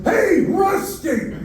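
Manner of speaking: high, slow drawn-out mock shout; mock yell. People teasing the older man in a mock yell. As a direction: bellow it like a teasing stranger. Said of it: "they're yelling".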